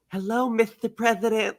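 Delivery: high, breathy mock Marilyn Monroe; an accidentally gruff Marilyn Monroe, like Mike Tyson doing her; Marilyn Monroe impression. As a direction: coo it like a bad Marilyn Monroe. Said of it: "I can't picture her doing that voice".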